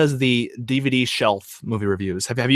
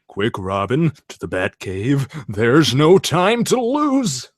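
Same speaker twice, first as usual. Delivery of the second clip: slow campy Adam West voice; Batman voice; slower than usual. Pretend. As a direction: overplay it like old Batman. Batman and Robin bit.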